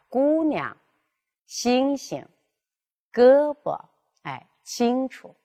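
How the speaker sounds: 姑娘 星星 胳膊 清楚 with the first syllable stretched, second light; lengthened first syllable. Shown as a demonstration. Teacher modelling corrected reading for student, deliberate. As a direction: draw out 姑 星 胳 清, then drop the neutral tone. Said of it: "这样去读".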